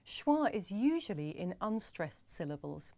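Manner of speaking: ordinary delivery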